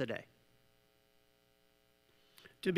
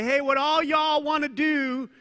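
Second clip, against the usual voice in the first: mock open invitation; 7 semitones higher, 5 dB louder than usual. Acting out an open-to-all offer.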